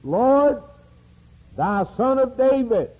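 a blind man crying out for mercy. Retold or imitated. Blind beggar calling out to passing Jesus, loud plea. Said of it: "And he cried out".

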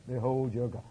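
soft, low repeat of the proclamation; hushed echo. Acted. Preacher echoing the prophet's cry, soft and low. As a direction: pull back to a reverent hush.

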